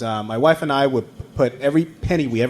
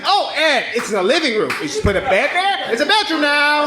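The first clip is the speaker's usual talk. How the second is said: realtor lady; 12 semitones higher than usual. Retold or imitated. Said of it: "And lady's like".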